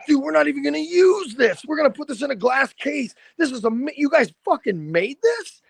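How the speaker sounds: high, awed retelling of a thrilled fan; amazed band guy. Retold or imitated. Band member marveling at the handmade pipe, high and awed. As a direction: gush like he can't believe the gift. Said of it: "and he's like".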